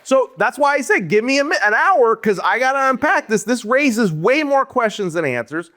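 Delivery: a rant gathering steam; worked-up rant. Real, not acted. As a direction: fire it off, all riled up. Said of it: "excited rant, pitched up".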